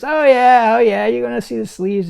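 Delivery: loud, high-pitched jeering imitation; imitated singsong-high mockery, belted out; mocking. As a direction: act out her loud, taunting glee. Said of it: "she's making fun of him".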